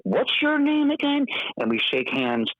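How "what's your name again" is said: high, slow, impressed question; newly respectful. Retold or imitated. CEO now interested in the guest, high and slow. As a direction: play the suddenly attentive CEO. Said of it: "So he was just like".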